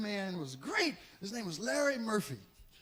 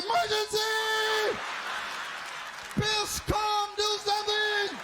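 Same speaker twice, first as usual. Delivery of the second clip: panicked shouting; 10 semitones higher, slower than usual. Pretend. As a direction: yell like a fire alarm. Dick voiced yelling in the fire.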